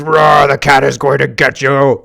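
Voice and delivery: loud, slow mock growl; loud, slow mock growl telling the cat will get you; mock monster roar. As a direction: ham up a scary cat. Mocking scary cat claim.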